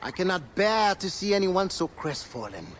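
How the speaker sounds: usual speaking voice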